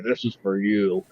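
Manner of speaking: put-on low, slow old man voice; mock solemn old man; imitating old man. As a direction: hand it over in a deep, drawn-out mumble-free drone. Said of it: "He's like".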